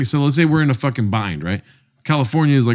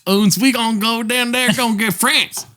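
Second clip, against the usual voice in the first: higher, hammed-up German-Southern accent; a goofy German-Southern accent, pitched up; 10 semitones higher than usual. Mixing German and Southern accents as a joke for a friend, higher. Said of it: "mix a German and Southern accent".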